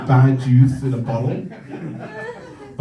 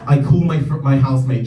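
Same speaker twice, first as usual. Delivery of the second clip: usual speaking voice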